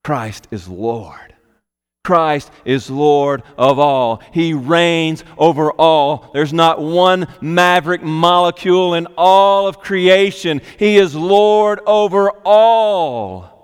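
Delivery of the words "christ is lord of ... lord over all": preaching. Acted. Building declaration of lordship.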